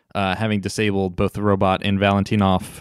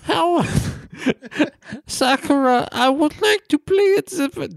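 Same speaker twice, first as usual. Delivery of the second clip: put-on terrible French accent. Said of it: "That's a terrible accent".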